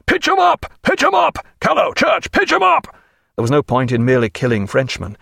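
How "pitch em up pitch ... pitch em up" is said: high repeated shouts; shouted orders. A narrator's reading. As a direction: hammer the order over the guns. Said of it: "he cried".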